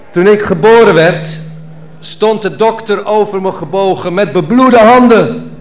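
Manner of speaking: performance poem. Acted. Poet performing his birth story on stage, declaiming. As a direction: perform the verse with mock drama.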